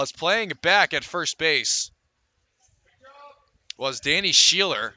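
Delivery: ordinary delivery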